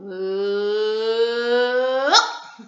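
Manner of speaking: long rising "ooh" that pops out; voice-throwing sound. Shown as a demonstration. Teacher showing nursery children how to throw their voices, long rising whoop. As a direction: hold a hum, sliding up to a sudden release. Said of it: "throw our voices out of our voice".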